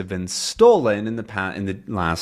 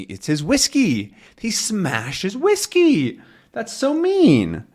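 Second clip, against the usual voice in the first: high, slow mock outrage; drawn-out pretend indignation for the captain; 12 semitones higher, slower than usual. Teacher explaining Haddock's smashed whiskey, mock-indignant. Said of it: "Haddock is now angry".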